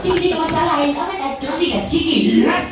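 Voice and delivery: high, loud squeal of joy; delighted queen. Acted. Queen excited about tikka masala, high squeals. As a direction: gush like a thrilled queen.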